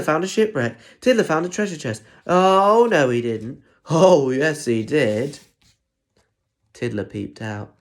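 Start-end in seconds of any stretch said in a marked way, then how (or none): 2.3-5.4 loud, high oh no he didn't; loud pantomime back-and-forth; panto call and response. Acted. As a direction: whip up the kids like a panto.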